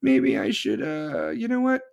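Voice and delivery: high, slow, simpering hesitation; mock Pritzker. Mocking Pritzker's change of heart with a high simper. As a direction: play him as coy and fake-humble. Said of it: "there's J B Pritzker like".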